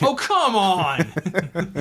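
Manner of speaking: slow, laughing exasperation; drawn-out oh come on; exasperated. Pretend. Acted frustrated player exclamation.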